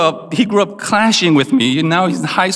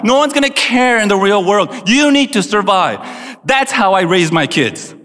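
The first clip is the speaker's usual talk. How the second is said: higher-pitched, insistent scolding; stern parent rant; 6 semitones higher than usual. Acted. Parent refusing excuses about mental illness, stern rant. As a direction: lay down the law as a hard-line parent.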